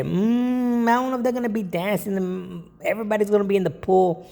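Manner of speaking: high doubtful party host voice; doubtful client. Retold or imitated. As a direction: mimic a hesitant host, high-pitched. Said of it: "But if they say".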